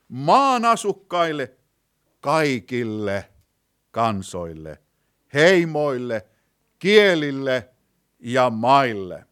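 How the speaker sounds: loud, slow, item-by-item proclamation; preacher's forceful list with weighty pauses; emphatic proclamation. Real, not acted. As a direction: ring out each group separately.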